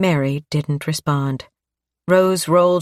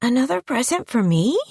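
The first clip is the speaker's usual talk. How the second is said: put-on little girl voice; 9 semitones higher than usual. A narrator's reading.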